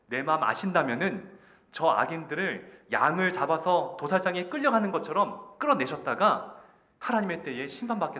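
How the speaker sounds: loud, rising acted demand; forceful plea. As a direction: press God urgently in the prophet's place. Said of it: "강력하게 요청합니다". (강력하게 호소하는 목소리)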